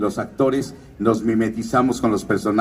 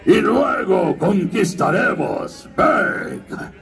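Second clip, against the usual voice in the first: slow, high, booming villain threat; Drago Bludvist voice; 8 semitones higher, much slower than usual. Retold or imitated. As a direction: drag out a warlord's threat. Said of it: "Drago Manodura".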